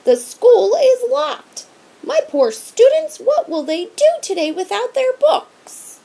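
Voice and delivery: high, loud fussing schoolteacher; teacher wailing about missing books; distraught teacher. A narrator's reading. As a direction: wail over the poor students.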